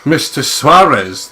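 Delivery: hammed-up villain greeting, slow; Bond villain. [name] greets the father as a Bond villain, hammed-up. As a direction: savour the name like a movie villain. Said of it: "nice Bond villain thing".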